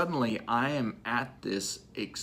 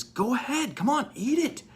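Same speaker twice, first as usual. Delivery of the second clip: much higher, eager and bubbling over; excited Jesus; 14 semitones higher than usual. Retold or imitated. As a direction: urge him on with delight. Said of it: "oh, excitedly says".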